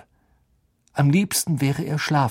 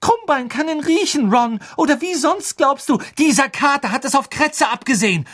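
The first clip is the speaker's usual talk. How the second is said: high, loud, agitated quarrelling; heated arguing; 13 semitones higher, 5 dB louder than usual. A narrator's reading. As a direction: argue back with raised voice. Hitziger Streit zwischen Ron und Hermine. (hitzig streitend)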